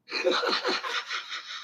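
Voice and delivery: real high squeaky giggle, almost breathless; high laugh. Real, not acted. Streamer laughing at his own joke to chat, high giggle. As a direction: let a shrill cackle escape. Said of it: "I sit in this chair laughing at everybody".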